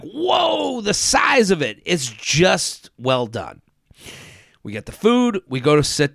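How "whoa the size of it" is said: high, amazed whoa at the size; mock awe. Mock awe at a giant dessert buffet. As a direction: gasp at a huge buffet. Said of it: "a buffet that you're like".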